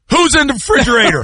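high, fast mock demand; imitated suspicious dad. Dad hearing the door open, high quick mock challenge. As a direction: fire it off high, amused. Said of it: "You be".

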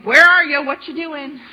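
loud, high where are you, then dropping off; blunt frustrated cry. Retold or imitated. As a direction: shout the question at God. People essentially going.

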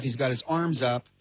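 ordinary talk

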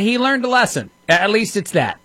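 sarcastic. Real, not acted. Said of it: "I'm being sarcastic as I say that".

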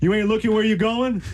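high-pitched, indignant New York challenge; New York accent. Shown as a demonstration. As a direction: scold a careless driver in New York style. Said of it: "I wanna hear some more".

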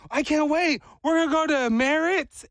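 put-on high-pitched eager kid voice; excited little boy. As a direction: squeal it like a child who can't wait. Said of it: "he was like".

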